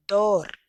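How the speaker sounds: dor punched loud; the stressed dor of tenedor, said with force; stressed syllable. Shown as a demonstration. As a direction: hit the final syllable dor hard. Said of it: "donde hacemos mayor fuerza de voz".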